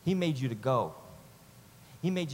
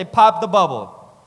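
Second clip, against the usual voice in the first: loud, slow shouted slogan; call to neighbor; 13 dB louder, slower than usual. Shown as a demonstration. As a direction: belt it out for the room to repeat. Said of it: "Turn to your neighbor and say".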